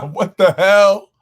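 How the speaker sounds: high, loud burst of disbelief; stunned outburst. Real, not acted. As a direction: blurt it out, you can't believe what you just saw. Stunned by the game's turn.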